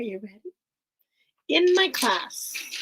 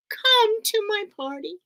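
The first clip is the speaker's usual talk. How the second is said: witch voice; 7 semitones higher than usual. Acted. Witch reads invitation.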